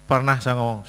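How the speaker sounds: ordinary delivery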